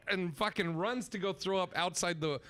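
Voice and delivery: ordinary delivery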